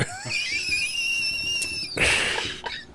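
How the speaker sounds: high breathy laugh; squealing laugh. Real, not acted. Laughing at his own joke, high and airy.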